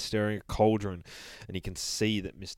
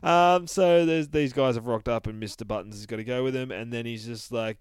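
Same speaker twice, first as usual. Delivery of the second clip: ordinary delivery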